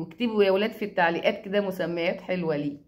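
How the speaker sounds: ordinary talk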